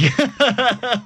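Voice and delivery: real burst of laughter, high. Real, not acted. Laughing at own joke.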